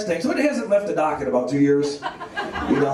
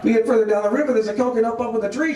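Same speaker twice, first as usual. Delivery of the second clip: ordinary talk, nothing marked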